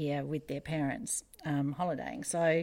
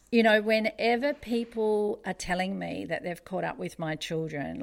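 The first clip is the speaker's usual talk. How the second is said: ordinary talk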